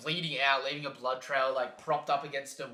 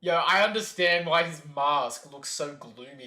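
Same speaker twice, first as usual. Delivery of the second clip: normal talking voice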